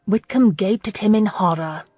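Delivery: plain reading voice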